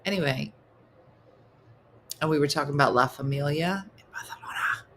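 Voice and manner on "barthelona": "Barcelona" dropped to a whisper, th for c; whispered lisped Barcelona. Acted. Telling of La Familia in the city, whispered lisped Barcelona. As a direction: whisper just "Barcelona", Spanish-style. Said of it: "You have to whisper it".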